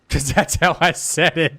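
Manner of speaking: laughing. Real, not acted. Laughing at his own reading.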